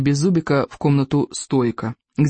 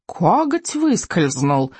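whining dragon; much slower than usual. Acted. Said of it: "заскулил Крючкозуб".

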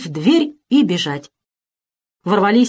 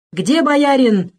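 roar; 6 semitones higher, slower than usual. Acted. Razin demands the boyar from the circle, slow roar. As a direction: roar it out, furious at the crowd. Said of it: "взревел".

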